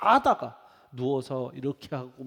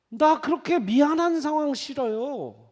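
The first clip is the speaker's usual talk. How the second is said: whiny refusal; 10 semitones higher, 8 dB louder than usual. Retold or imitated. Voicing young people.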